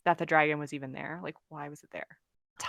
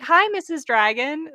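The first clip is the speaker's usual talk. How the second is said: high, loud delighted hello; cheerful greeting; 9 semitones higher, 8 dB louder than usual. Retold or imitated. Tim riding the dragon, greeting her with glee. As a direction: greet the dragon like a happy kid.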